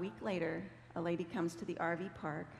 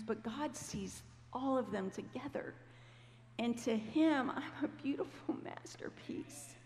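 high, softening, emotional quote; imitating a moved friend, fading to breath; retold tearful; 5 semitones higher, slower than usual. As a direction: let it rise and thin out on the final words. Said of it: "she started crying".

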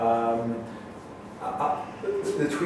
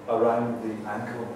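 soft, rhythmic word repetition; poem recital; 5 dB quieter than usual. Acted. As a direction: repeat in a quiet, even patter, then land the last word. Reads own poem aloud.

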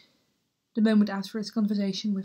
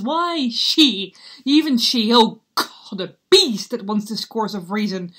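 furious; 8 dB louder than usual. Acted. Raging at mother's remarriage.

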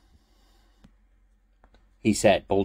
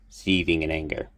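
usual speaking voice

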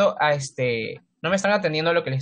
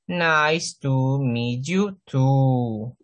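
nice to meet you too, very slow; slow model phrase; 3 semitones lower, much slower than usual. Shown as a demonstration. Teacher slowly modelling the goodbye for the class. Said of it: "cuando te despides al final".

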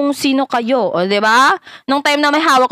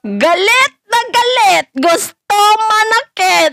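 mocking; 7 semitones higher than usual. Mocking rival's angry face.

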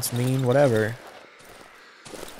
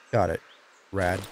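ordinary talk, nothing marked

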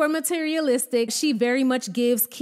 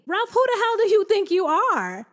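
indignant; 8 semitones higher than usual. Real, not acted. Scolding Ralph on screen.

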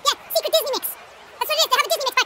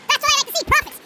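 excited shout; 6 semitones higher than usual. Acted. Retells God shouting, then cheers.

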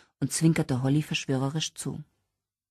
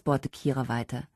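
ordinary delivery

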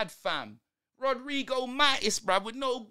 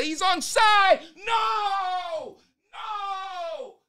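loud, high cry of "No!"; a fan shouting in disbelief as the goal stands; anguished shout; 8 semitones higher, 4 dB louder, much slower than usual. Real, not acted. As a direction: yell at the screen in despair.

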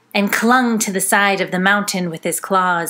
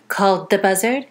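ordinary delivery, nothing marked

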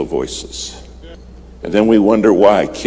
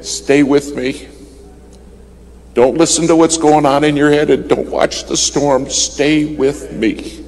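urgent repeated plea; 7 semitones higher than usual. Retold or imitated. Replaying the controller's urgent call.

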